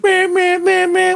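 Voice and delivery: high whiny meh meh beeping; squeaky imitation of car horn; mocking horn beep.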